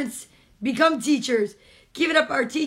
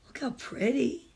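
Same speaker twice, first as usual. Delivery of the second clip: ordinary delivery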